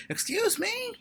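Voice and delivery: put-on high little kid calling out; child's voice. Mimicking her son interrupting the President, high and piping. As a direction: squeak it like a four-year-old.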